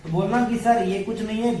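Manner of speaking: ordinary delivery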